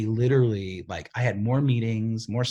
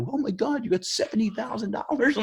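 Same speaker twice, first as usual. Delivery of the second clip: much higher, amazed friends' gasp at the balance; amazed friends; 14 semitones higher than usual. Retold or imitated. Friends gawking at his ATM balance, squealing in amazement. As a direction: shriek it like stunned buddies seeing the bank balance.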